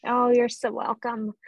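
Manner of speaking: normal talking voice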